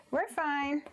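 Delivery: high-pitched, cheery reassurance; chirpy self-reassurance. Real, not acted. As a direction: brush off the slip in a high voice. Repeats it to reassure after slip.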